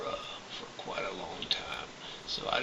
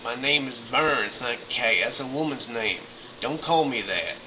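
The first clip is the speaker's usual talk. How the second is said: louder, higher, insistent; angry correction; 4 semitones higher, 7 dB louder than usual. Retold or imitated. To the customs officers, loud and angry. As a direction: demand they use your real name. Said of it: "I'm like".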